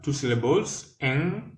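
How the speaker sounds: ordinary talk, nothing marked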